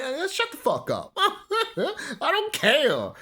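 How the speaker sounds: high, laughing dismissal; laughing rant. Real, not acted. Vlogger brushing off hype, laughing high.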